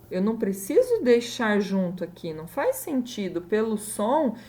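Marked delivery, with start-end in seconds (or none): none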